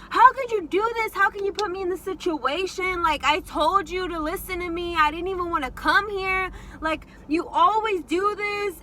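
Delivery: high-pitched, heated mock tirade; retold angry screaming. Teller blaming her friend afterwards, acted angry scolding. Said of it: "I started screaming at Banyo".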